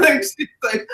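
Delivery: high, helpless laughter; cracking up mid-story; laughing hard. Real, not acted. Laughing at how she scared the stoned girl. Laughing while telling the paranoia prank.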